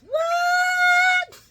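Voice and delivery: high, loud squawk of disbelief; mock shock. Vlogger reacting to Anna's new job, mock-shocked shriek.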